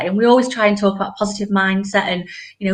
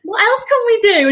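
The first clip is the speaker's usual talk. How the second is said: much higher, louder eager question; excited child; 11 semitones higher, 4 dB louder than usual. Retold or imitated. Daughter asking mum for more affirmations, excited. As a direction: ask it bursting with eagerness. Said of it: "she was so excited".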